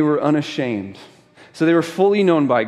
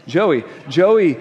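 Joey repeated louder and higher; emphatic correction; 5 semitones higher, 6 dB louder than usual. Shown as a demonstration. Preacher insisting the child's name is Joey, loud. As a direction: hammer home the name Joey. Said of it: "Or did I say Johnny?".

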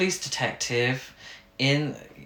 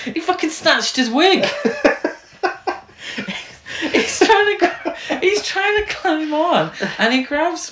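high-pitched, louder, cracking up mid-story; laughing hard; 4 dB louder than usual. Real, not acted. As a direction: squeal it out through real laughter. Cracking up retelling wig scene.

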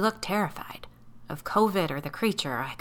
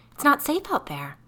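much higher, louder alarmed plea; frightened exclamation; 10 semitones higher, 6 dB louder than usual. Acted. Sally warning Biddy, high and loud in fear. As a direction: cry out in fear for them. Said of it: "Sally exclaimed".

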